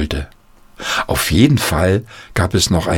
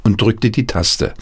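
usual speaking voice

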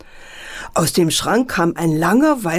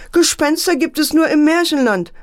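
scolding; 9 semitones higher than usual. A narrator's reading. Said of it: "Mutter guckte ärgerlich".

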